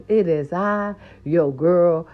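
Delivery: normal talking voice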